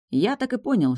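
plain reading voice

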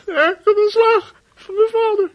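choked, tearful telling; sobbing it out, high and loud; crying. Acted. Upset boy tells of being scolded. (huilend en met overslaande stem)

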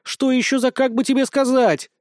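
high, mocking echo of "как бы тебе сказать"; indignant mock repeat. Cat mocks the hero's hedge, high indignant echo. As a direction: throw his own words back at him, offended. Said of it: "возмутилась Уся".

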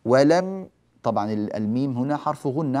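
ordinary delivery

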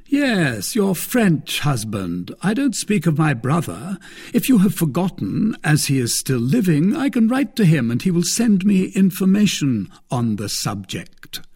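a smug, teasing brother-in-law; Lord de Winter. Acted.

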